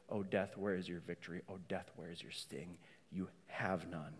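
soft, low, settled defiance; hushed defiance. Acted. Preacher closing the taunt to death in a soft, low voice.

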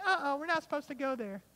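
put-on high, hesitant girl-cub voice; nervous little cub pulling back; worried Nala. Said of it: "And she's like".

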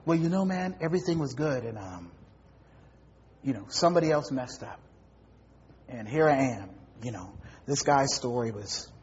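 low, soft mumbled excuse story; lightly voiced mumbling drifter; mumbling beggar. A hint of the beggar mumbling his excuse to the pastor. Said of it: "Here comes the story".

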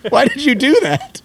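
high, laughing mock exasperation; mock plea to Peter McNichol, laughing and high. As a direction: beg him through laughter to just open the door. Retold exasperated reaction to actor.

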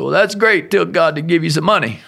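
high, loud mock enthusiasm; sarcastic retort. Husband answering his wife's property call, sarcastic. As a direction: toss it back with exaggerated sarcasm.

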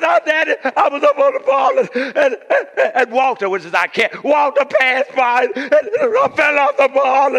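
put-on high, loud, halting child's blubber; sobbing little daughter. Hurt daughter explaining the fall to Daddy, imitated sobbing. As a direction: blubber it out between mock sobs.

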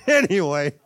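laughing it off. Real, not acted. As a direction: shrug it off with a laugh. Laughs off own joke.

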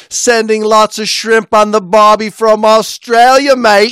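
high, loud mock Australian accent, hammed up. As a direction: ham up an Aussie greeting from a listener. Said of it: "Australian accents 'cause she says".

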